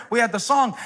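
ordinary delivery

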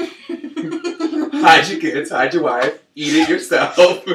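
laughing mock warning. Joking about the delicious batter.

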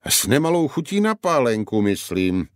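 ordinary delivery, nothing marked

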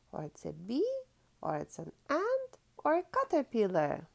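ordinary delivery, nothing marked

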